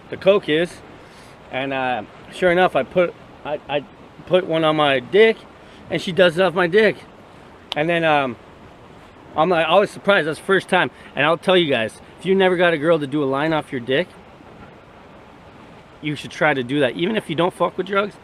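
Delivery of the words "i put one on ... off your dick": normal talking voice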